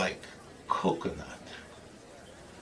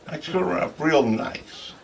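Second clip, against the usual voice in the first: usual speaking voice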